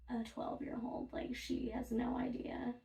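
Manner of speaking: hushed whisper aside. Real, not acted. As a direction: whisper it like a secret.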